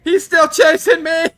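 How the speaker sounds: high, loud panicked shout; yelling while being chased. Real, not acted.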